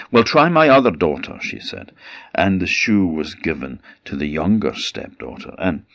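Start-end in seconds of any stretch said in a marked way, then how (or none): 0.1-1.4 quick, louder pushy mother; eager stepmother. Acted. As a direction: rush it out, pushing her daughter. Stepmother urges her other daughter.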